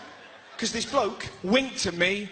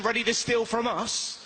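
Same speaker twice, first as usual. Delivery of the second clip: ordinary talk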